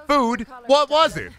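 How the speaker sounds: high squeak, puzzled; squeaky confused voice. Pretend. Voicing the critic.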